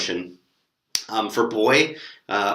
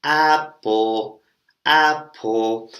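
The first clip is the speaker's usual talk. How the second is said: louder, chanted "Apple" three times; teacher demo; 4 dB louder, much slower than usual. Shown as a demonstration. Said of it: "and I go".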